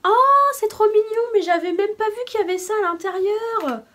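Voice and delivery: high, loud cry of delight; thrilled and loud. Real, not acted. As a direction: gush over something adorable. Delighted unboxing a cute item.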